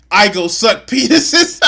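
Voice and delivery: loud, high, cracking up; punchline lost to laughter; laughing punchline. Real, not acted.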